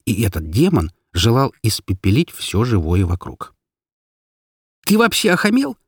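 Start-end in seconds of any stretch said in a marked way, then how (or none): 4.8-5.8 high-pitched outraged cry; indignant screamed demand; angry yell. A narrator's reading. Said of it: "закричала я".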